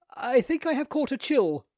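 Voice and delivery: high, loud, anxious blurt; desperate Theodoric. A narrator's reading. Theodoric to the lady, desperately covering his state. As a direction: blurt it out in a panic. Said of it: "he ventured desperately".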